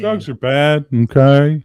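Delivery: loud, mock-stern PSA; loud mock lecture. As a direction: lay down the law loudly.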